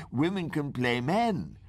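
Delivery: ordinary delivery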